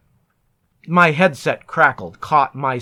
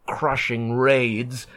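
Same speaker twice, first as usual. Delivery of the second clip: ordinary delivery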